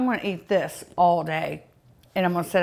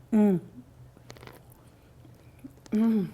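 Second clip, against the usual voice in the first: ordinary talk, nothing marked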